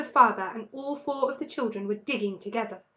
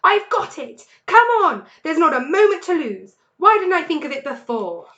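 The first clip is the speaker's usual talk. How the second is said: much higher, louder eureka; bursting with the idea; excited shout; 6 semitones higher, 12 dB louder than usual. A narrator's reading. Mr Fox to his family, shouting his idea.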